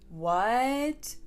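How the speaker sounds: long stretched what with rising pitch; drawn-out what. Retold or imitated. Arthur's reaction read aloud, stretched out. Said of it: "with a lot of A's".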